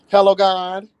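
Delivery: high campy exclamation; high sassy diva call; campy Hello God. Pretend. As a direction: toss it up to the heavens.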